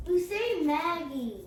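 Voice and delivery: yelling across the room. Real, not acted. Child calling from across room.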